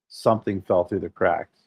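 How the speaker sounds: usual speaking voice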